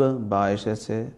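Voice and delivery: normal talking voice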